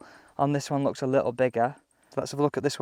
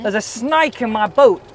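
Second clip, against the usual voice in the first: playful mock Australian accent, pitched up and louder; light Australian twang, half-joking impression; Steve Irwin accent; 7 semitones higher, 6 dB louder than usual. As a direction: ham up a quick Australian accent, Woody's line gone Aussie. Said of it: "I was a bit Steve Irwin".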